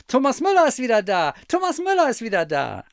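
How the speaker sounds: high, loud, quick cheering cry; excited shouting. A narrator's reading. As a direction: shout the news as you come running. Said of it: "angerannt und riefen".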